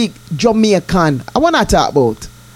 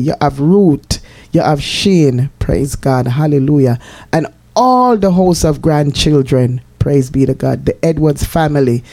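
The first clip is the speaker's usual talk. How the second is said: lower, solemn scripture reading; prayerful reading; 6 semitones lower than usual. Acted. To her listeners, blessing them in a solemn prayerful voice. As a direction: read the psalm and bless them reverently.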